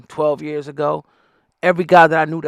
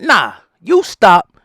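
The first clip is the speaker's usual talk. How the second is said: very slow, louder, with "you" pushed hard; firm retort; 5 semitones higher, 6 dB louder, much slower than usual. Real, not acted. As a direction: turn the finger back on them. Retort to preachy outsiders.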